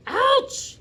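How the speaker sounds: very high, very loud yelp of pain; screamed. Acted. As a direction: shriek at the sudden bite. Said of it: "he screamed".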